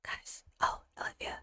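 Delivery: hushed, breath-only whisper; soft ASMR-style whispering. Shown as a demonstration. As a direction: whisper softly into the mic. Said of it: "you want an A S M R".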